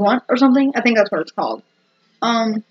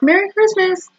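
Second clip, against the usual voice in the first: much higher, cheeky holiday wish; imitating Tyler; 9 semitones higher than usual. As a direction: wave it off with mock cheer. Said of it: "Tyler's like".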